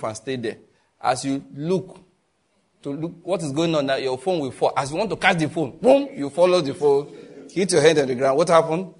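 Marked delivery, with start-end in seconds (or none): none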